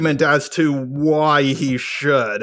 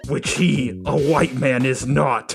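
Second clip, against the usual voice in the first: mock scheming; 3 semitones lower, 3 dB quieter than usual. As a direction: quietly savour the sneaky plan. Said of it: "writing this script thinking".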